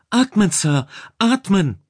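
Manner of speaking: high, loud, pressing command; urgent urging. A narrator's reading. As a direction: urge him hard to breathe. Rescuer urges choking man to breathe.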